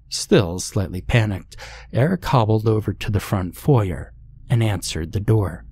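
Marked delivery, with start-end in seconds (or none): none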